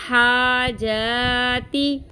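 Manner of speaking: normal talking voice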